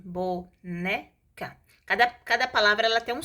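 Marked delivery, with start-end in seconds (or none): 0.0-1.6 "boneca" slowly split: bo, né, ca; syllable by syllable. Shown as a demonstration. Teacher showing how a divided word is said, syllable by syllable. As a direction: pause after each syllable of "boneca". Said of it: "então fala assim".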